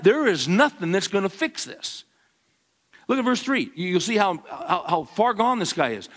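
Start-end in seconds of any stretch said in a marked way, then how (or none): none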